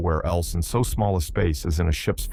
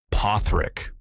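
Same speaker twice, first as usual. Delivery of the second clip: drawl; 2 semitones higher than usual. A narrator's reading. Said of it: "drawled a voice".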